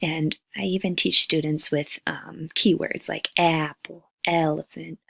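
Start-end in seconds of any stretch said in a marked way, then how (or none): none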